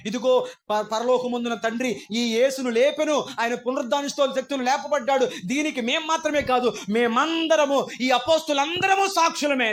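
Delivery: loud sermon proclamation; preaching at full force. Acted. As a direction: proclaim it like Peter before the crowd.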